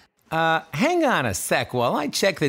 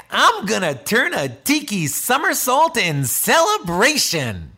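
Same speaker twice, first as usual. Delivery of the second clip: overjoyed; 6 semitones higher than usual. Acted. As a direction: gush like you could do a somersault. Tiki overjoyed at realization.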